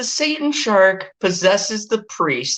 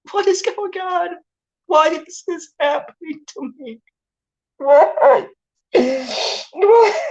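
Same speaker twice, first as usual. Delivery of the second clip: much higher, despairing wail at the movie; exasperated wailing; 10 semitones higher than usual. Retold or imitated. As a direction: plead with the film like it's torturing you. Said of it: "And I'm like".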